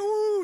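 imitating worshippers' soaring ooh; mock ooh, very high. Preacher mimicking the congregation's song motions, high ooh. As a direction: let a high ooh float up in mock delight. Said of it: "And they go".

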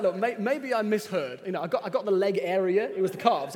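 laughing retold reply. Laughing, retelling eager reply.

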